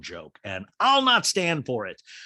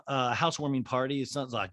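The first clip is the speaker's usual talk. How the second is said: usual speaking voice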